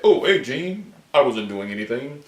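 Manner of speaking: high, mock-innocent voice of a man caught out; caught-out innocence. Acting out Cyclops greeting Jean after being caught, mock innocent.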